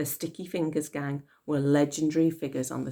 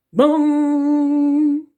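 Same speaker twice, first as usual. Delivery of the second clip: voiced bong like a big clock bell; 11 semitones higher, 10 dB louder than usual. A narrator's reading. Narrator voicing Big Ben striking, bell bong.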